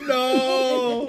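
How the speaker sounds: loud, high drawn-out no; mock outraged refusal. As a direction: retell a big dramatic no. Re-enacts the phone call refusal.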